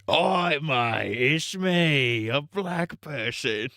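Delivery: high cheeky cockney "Oi, mate"; mock British accent. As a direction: play a silly Brit greeting a mate.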